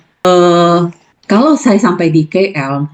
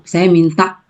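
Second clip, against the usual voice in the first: ordinary talk, nothing marked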